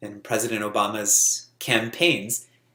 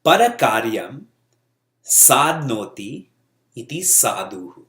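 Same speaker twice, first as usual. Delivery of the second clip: slow, deliberate recitation with pauses between words; slow reading; 4 dB louder than usual. Real, not acted. Teacher reads a Sanskrit definition to the class, slowly. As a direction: read out slowly, phrase by phrase. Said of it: "I'll read this slowly".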